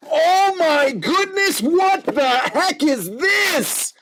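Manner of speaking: high, stunned and slow; excited gasp. Real, not acted. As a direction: gasp in real disbelief. Opening a card pack live.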